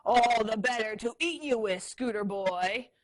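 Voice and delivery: loud raspy menacing whisper-roar. Acted. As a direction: growl it out to scare. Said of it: "roared Tank".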